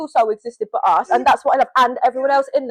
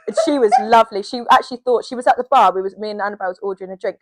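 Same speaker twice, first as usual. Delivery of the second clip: ordinary talk, nothing marked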